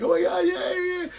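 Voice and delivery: very high-pitched mock squeal; ecstatic squeal. Partner hearing he just got signed, ecstatic squeal. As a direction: imitate someone squealing with overjoyed disbelief.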